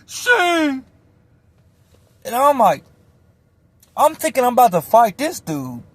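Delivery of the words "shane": high calling yell; high shout of a name for help; yelling for his homie. Retold or imitated. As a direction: call out for backup across the pod. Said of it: "He's yelling for his homie".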